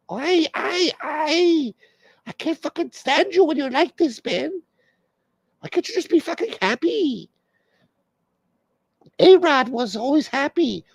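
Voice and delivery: hammed-up girlfriend nagging in falsetto; wife's voice. Comic voices [name] nagging [name], high mock girlfriend voice.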